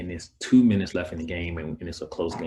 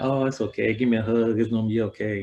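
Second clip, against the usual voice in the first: imagined comforting mom; 3 semitones higher, 4 dB louder than usual. Retold or imitated. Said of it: "I thought she was gonna be like".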